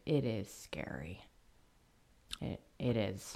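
quiet, serious hush. Real, not acted. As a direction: drop to a near whisper. Recalling being stalked.

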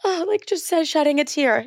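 high-pitched, hammed-up weepiness; mock tearful. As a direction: put on a tearful act. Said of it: "sit here and be like".

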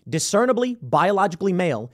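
normal talking voice

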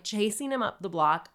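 ordinary talk

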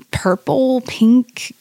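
rising, doubtful, as if asking herself; a psychic reporting colours while puzzling over her own words; unsure, questioning. Retold or imitated. Psychic unsure of what she sees, questioning tone. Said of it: "she was saying it kinda like, "What am I saying?".

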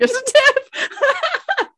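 high, slow, squealing through laughter; laughing. Pretend. Repeats line laughing.

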